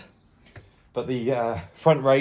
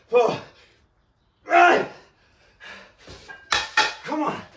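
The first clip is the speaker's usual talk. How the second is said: straining rep count; 13 semitones higher, slower than usual. Real, not acted. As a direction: push out the last reps. Final reps strain.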